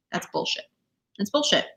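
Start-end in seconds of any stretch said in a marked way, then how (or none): 0.1-0.6 whisper. Real, not acted. Repeats a dismissal, first hushed.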